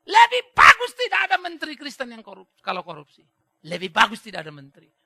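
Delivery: loud mock proclamation, easing off at the end; retold shout. Pastor quoting a church leader's speech on ministers, in a mock shout. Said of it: "Dia teriak begitu".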